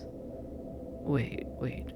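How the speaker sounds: soft, halting disbelief; stunned hush. Acted. Demon startled by angel's revelation, stunned hush. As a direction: stop short and drop the volume.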